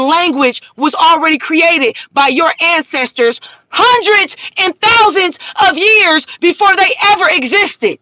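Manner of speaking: real worked-up preachy outrage; heated exasperation. Real, not acted. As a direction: scold them, voice climbing with indignation. Heated rant scolding the audience.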